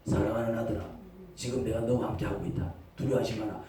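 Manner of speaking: low, soft, tender fatherly reassurance; God's tender voice. Acted. God comforting his son, low, soft and tender. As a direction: comfort a frightened son gently. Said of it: "이 말씀을".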